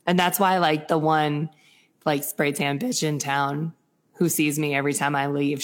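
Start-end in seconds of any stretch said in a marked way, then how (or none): none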